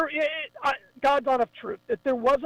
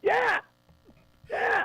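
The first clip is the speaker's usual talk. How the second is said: excited high yeah; 12 semitones higher than usual. Real, not acted. Agreeing loudly about Dragon Con.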